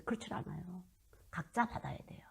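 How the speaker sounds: hushed, unvoiced emphasis; whisper. Real, not acted. Preacher whispering the point to congregation, hushed. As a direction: drop to a whisper to drive it home.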